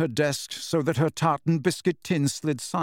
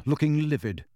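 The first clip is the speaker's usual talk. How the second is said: ordinary delivery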